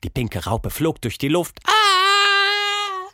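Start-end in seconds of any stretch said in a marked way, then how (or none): 1.6-3.1 very high, loud falling scream; high creature shriek fading away. Acted. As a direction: shriek as you fly off. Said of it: "schrie sie".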